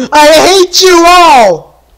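slow, high, loud rage; furious shout. Real, not acted. Player hating all the monsters, furious shout. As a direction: shout it drawn out in exasperated fury.

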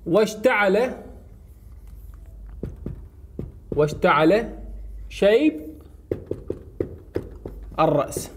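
واشتعل شيب الرأس spaced out with long pauses; slow word by word. Shown as a demonstration. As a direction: dictate it word by word, pausing after واشتعل and after شيب. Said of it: "شوف". (نطق كلمة كلمة ببطء مع وقفات)

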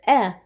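eh as a short clipped vowel; short eh sound. Shown as a demonstration. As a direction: say eh short, like in bed. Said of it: "the short E".

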